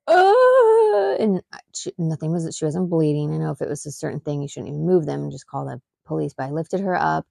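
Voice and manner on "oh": high, loud crying oh; imitating daughter's pained crying oh; hurt child's cry. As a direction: wail it like a hurt little girl. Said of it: "'cause she's like".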